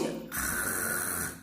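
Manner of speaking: soft voiceless guttural R, held long; throaty R. Shown as a demonstration. Teacher demonstrates the strong R, throaty rasp. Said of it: "treme na garganta".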